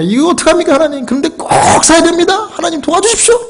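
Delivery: high-pitched, urgent pleading to God; desperate prayer. Retold or imitated. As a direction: beg for help in a strained high voice. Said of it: "내일처럼 그렇게 기도했습니다".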